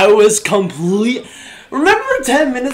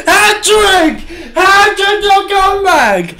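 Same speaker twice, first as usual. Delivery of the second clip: much higher and louder, yelling the goal; ecstatic goal shout; 11 semitones higher, 5 dB louder, much slower than usual. Real, not acted. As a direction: scream it like the goal just went in. Streamer's team scores tying goal.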